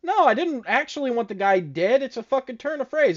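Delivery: high-pitched retold objection; mock protest. Acts out victim protesting to djinn.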